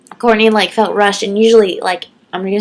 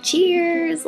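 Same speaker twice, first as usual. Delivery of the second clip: high, drawn-out, soft cheers; smiling toast; 10 semitones higher, 5 dB quieter than usual. Retold or imitated. Vlogger retelling raising her drink to him, smiling. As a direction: toast through a huge smile. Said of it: "like, with a huge smile".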